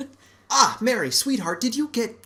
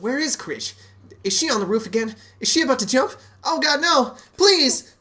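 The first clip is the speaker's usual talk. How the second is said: rising, louder frantic pleading; mock panic; 7 semitones higher, 4 dB louder than usual. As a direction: panic that the kid will jump. [name] panics about child on roof.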